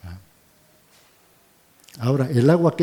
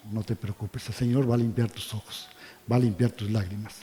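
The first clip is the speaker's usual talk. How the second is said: reassuring; 4 semitones lower, 8 dB quieter than usual. Real, not acted. Consoling those who weep.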